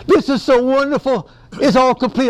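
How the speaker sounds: high, thrilled outpouring; acted-out elated wonder; excited. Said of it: "he's so excited".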